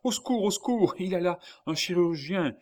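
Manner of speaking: very high, loud urgent cry; calling for help. A narrator's reading. Old man seeking a surgeon for his child, crying out. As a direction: cry out for rescue.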